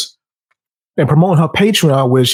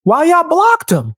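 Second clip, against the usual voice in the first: high, drawn-out mock indignation; imitated protest; 11 semitones higher than usual. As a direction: imitate a friend jumping in to object, pitched way up. Retells friend jumping in to protest.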